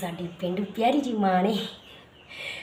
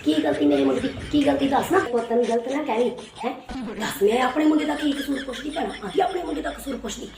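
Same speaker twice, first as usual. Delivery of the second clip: a brash rural mother picking a fight; scolding village mother. Acted. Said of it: "ਸਾਡੀ ਪੇਂਡੂ ਮਾਂ ਵੀ".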